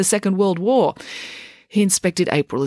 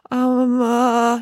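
higher flustered um and uh; flustered boy hesitating; spluttering; 8 semitones higher, 4 dB louder than usual. A narrator's reading. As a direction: caught off guard, spluttering. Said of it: "he spluttered".

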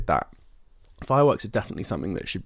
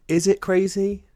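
incredulous; 12 semitones higher, 5 dB louder than usual. Acted. Repeats with high incredulity.

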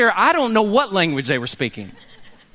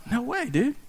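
dismissive refusal; 3 semitones higher, 8 dB quieter than usual. Retold or imitated. Churchgoer refuses sticker.

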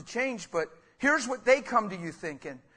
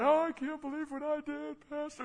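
mock crying; 8 semitones higher than usual. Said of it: "People come to me crying".

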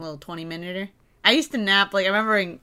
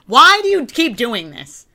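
mock scolding; 7 semitones higher, 9 dB louder, slower than usual. Real, not acted. Scolding Nate over the banana.

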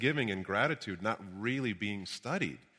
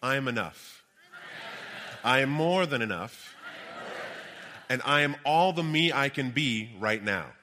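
booming motivational speech. Shown as a demonstration. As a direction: lead the room in affirmations. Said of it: "Repeat after me".

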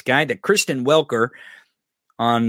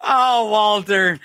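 laughing; 7 semitones higher, 3 dB louder, much slower than usual. Real, not acted. Praising after laughing.

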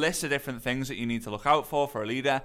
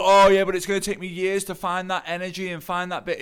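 higher, louder mock complaining; put-on protesting voice, raised and insistent; mimicked objection; 5 semitones higher, 7 dB louder than usual. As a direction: parody someone making excuses.

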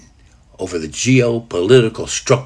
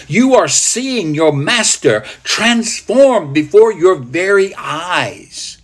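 impassioned preaching; 7 semitones higher than usual. Acted. Preacher describes the master transformed, impassioned preaching. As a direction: preach it with soaring intensity. Preacher retelling at full force.

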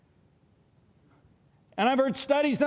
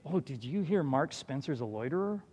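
hushed gossip; 8 semitones lower, 7 dB quieter than usual. Retold or imitated. Gossip punchline after claiming none.

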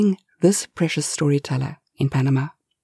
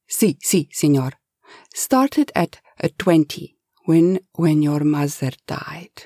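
put-on pleading Spanish accent. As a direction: beg the boss in a Spanish accent. Eustasio sympathizes.

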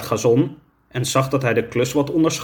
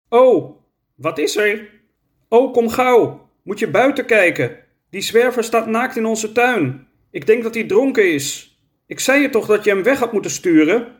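alarmed excitement; 9 semitones higher than usual. Acted. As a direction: call your friend over in a panic. Ro alarmed, calling Oroka over. (hoog en opgewonden, geschrokken roepend)

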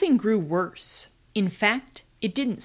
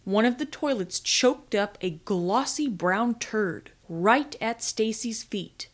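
ordinary delivery

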